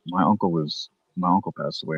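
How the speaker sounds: softly imitating her sad tone; quiet sad quote. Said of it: "She's like".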